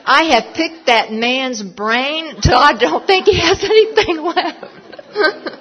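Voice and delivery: loud, rising into a high laughing finish; comic exaggeration. Real, not acted. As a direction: build loudly into a squeal of amusement.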